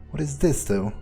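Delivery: normal talking voice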